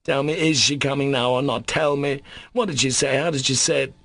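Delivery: pressing, insistent; urgent questions. Acted. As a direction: demand an answer now.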